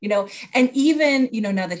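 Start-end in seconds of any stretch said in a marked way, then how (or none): none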